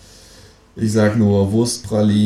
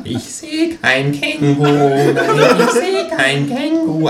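slow, rising panicky denial; insist, climbing higher; denying psychiatrist; 8 semitones higher, 4 dB louder, slower than usual. A narrator's reading. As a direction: psychiatrist insisting there is no kangaroo.

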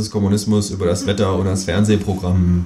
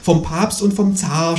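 moaning; 8 semitones higher than usual. A narrator's reading. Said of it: "stöhnt das Gespenst".

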